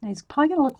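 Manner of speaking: ordinary delivery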